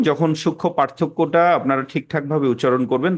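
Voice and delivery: usual speaking voice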